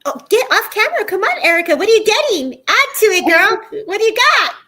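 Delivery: high, loud, eager call to a friend; excited calling out. Real, not acted. Medium excitedly urging Erica to share her reading. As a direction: cheer her on to speak up.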